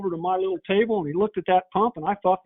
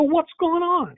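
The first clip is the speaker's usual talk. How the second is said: surprised question; 10 semitones higher, slower than usual. Retold or imitated. Teller alarmed at shredded impellers.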